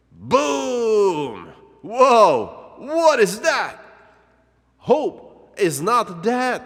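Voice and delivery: high, loud burst of astonishment; amazed shout. Acted. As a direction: cry out as if you can't believe your eyes. Sudden shock at revived twig.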